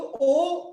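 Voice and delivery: ओ stretched out long. Shown as a demonstration. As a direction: hold ओ as a long vowel. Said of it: "पहला तो ओ दीर्घ स्वर है". (ओ को दीर्घ स्वर में लंबा खींचकर)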